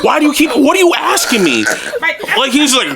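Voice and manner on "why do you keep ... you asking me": higher-pitched exasperated demand; worked-up, bewildered questioning; angry and confused. Retold or imitated. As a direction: retell it as baffled irritation. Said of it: "I'm so angry and confused".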